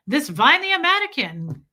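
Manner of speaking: put-on German accent dismissing someone. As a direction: imitate a scornful German woman. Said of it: "why Sophia comes here".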